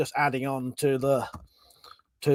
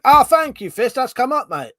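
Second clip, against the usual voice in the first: high, loud burst of real delight; excited thanks; 8 semitones higher, 9 dB louder than usual. Real, not acted. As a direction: thank the donor, thrilled it came in. Donation arrives on stream.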